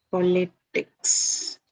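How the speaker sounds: politics drawn out very slowly; slow, segmented "politics"; syllable by syllable. Shown as a demonstration. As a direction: split politics into separate beats. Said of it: "how many syllable in this".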